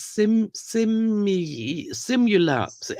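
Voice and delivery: false starts on "sim-, simi-, simula-"; struggling to pronounce. Shown as a demonstration. Woman showing a word she cannot say, struggling to pronounce. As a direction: stumble on "simula-", breaking off after each try. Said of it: "I cannot say certain words".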